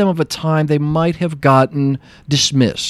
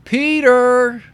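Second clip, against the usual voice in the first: calling name out; 12 semitones higher than usual. Retold or imitated. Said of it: "my name being called".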